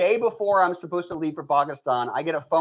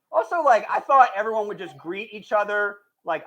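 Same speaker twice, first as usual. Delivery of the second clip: ordinary delivery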